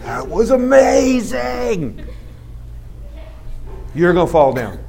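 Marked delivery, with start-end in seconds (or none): 0.0-2.0 high, very drawn-out cheer; slow, soaring praise; acted amazement. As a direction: stretch it high with awe. Acts encourager praising a fall.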